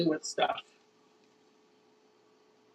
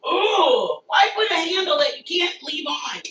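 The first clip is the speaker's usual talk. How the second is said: ordinary talk, nothing marked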